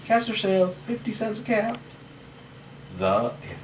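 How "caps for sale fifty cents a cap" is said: high, singing-out street seller's call; calling out wares. A narrator's reading. Peddler calls as he went along, calling out.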